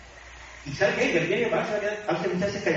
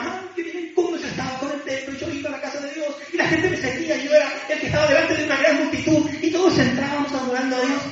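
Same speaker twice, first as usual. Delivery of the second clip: high-pitched wistful reminiscing; mock nostalgia; 6 semitones higher than usual. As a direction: play someone dwelling on past glory. Acting out someone stuck in memories. (añoranza fingida, en tono agudo)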